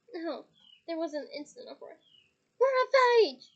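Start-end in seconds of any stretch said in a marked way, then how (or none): 2.6-3.5 much louder, higher bellow. Acted. Twins protesting to their mother, loud bellow. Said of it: "Fred and George bellowed together".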